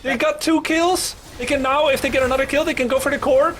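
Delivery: high excited play-by-play; esports caster. Acted. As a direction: call the fight at full hype. Live play-by-play hype.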